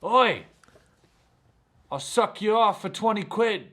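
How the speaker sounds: imitating a girl shouting from the street; girl calling out. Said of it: "I hear this girl go".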